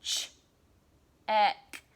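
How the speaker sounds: ch and ek pulled apart with a gap between; segmenting the sounds of check aloud; word stretched into sounds. Shown as a demonstration. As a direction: sound out ch, then a loud ek. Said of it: "said it really slowly and listened for the sounds".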